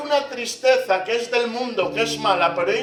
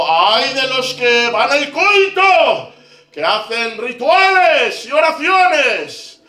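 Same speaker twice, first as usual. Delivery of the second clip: full-force preaching; 12 dB louder than usual. Acted. Preacher to the congregation, crying out Isaiah's woes at full volume. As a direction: thunder it out like a prophet.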